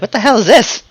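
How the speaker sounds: high-pitched, incredulous outburst; baffled reaction. Retold or imitated. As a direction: act out bewildered disbelief, pitch jumping up. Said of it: "you're like".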